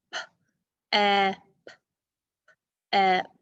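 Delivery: "p", "eh", "p" each sounded alone with gaps; separate sounds. Shown as a demonstration. Teacher showing three sounds in first syllable, sounded out separately. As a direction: sound out "p", short "eh", "p" one by one. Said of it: "We have three sounds".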